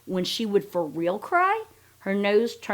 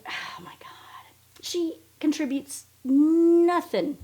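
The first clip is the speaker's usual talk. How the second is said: breathy unvoiced aside, slow; whisper; 9 semitones higher, slower than usual. Real, not acted. Gossiping to viewers about Robin, whispered. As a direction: hush it like a juicy secret.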